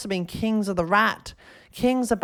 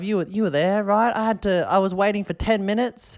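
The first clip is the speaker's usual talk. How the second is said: usual speaking voice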